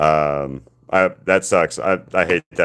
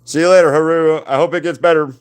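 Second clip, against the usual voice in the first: cheery farewell call; 11 semitones higher, 8 dB louder than usual. Real, not acted. Calling goodbye to viewer.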